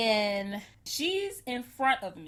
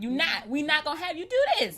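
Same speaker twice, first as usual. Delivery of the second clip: louder, firm refusal; spirit refusing; 6 semitones higher, 3 dB louder than usual. Retold or imitated. Her spirit refusing to let her keep watching, firm. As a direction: put your foot down, louder. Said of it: "my spirit was not".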